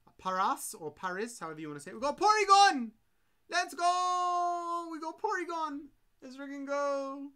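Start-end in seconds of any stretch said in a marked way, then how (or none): 1.9-7.3 high, loud cheering over the pull; excited shout. Real, not acted. Streamer cheering a Porygon pull to chat, shouting with joy. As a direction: yell it out like you just hit the jackpot. Pulling a wanted card on stream.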